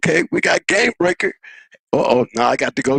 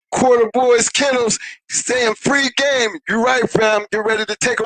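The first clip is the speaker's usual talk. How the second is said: stretched, higher hype call; hype shout; 7 semitones higher than usual. Real, not acted. Hyping shout outs on stream.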